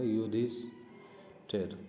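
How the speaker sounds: युधिष्ठिर drawn out very slowly and softly; wrong form said slowly. Shown as a demonstration. Teacher warning students, slow wrong form. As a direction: stretch युधिष्ठिर slowly to show the wrong spelling. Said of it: "exam में क्या करेगा".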